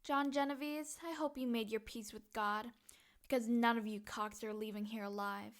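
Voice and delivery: low-volume, steady menace; soft-spoken deadly promise; quiet threat. Acted. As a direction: deliver a hushed, cold warning. Threatens the gangsters before attack.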